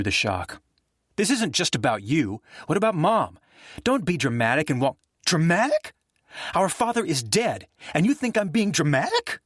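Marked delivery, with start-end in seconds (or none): 1.2-5.0 kindled anger. Acted. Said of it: "Anger kindled in Nick's chest". 5.2-9.4 incredulous fury. Acted. Brothers quarrel at father's deathbed.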